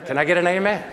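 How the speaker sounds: rally the room for a response; calling for amen. Real, not acted. Preacher to congregation after a football joke, loud rallying call.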